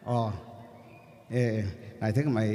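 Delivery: ordinary talk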